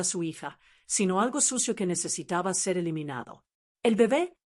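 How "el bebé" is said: usual speaking voice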